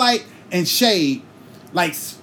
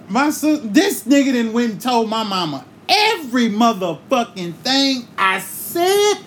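ordinary talk

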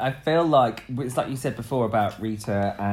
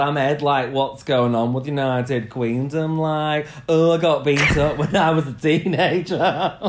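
louder, hammed-up Yorkshire impression; exaggerated Yorkshire accent; 4 dB louder than usual. Host impersonates co-host from Huddersfield, broad Yorkshire. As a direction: lean hard into broad Yorkshire to mimic your co-host. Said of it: "people tend to lean into my Yorkshire".

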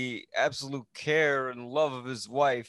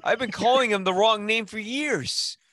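higher-pitched disbelief at his own blunder; dismayed exclamation; 9 semitones higher, 4 dB louder than usual. Real, not acted. Host realizing he misnamed the director for years, dismayed exclamation.

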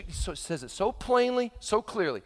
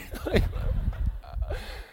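breathy high laugh; 11 semitones higher than usual. Real, not acted. Laughing at own skit.

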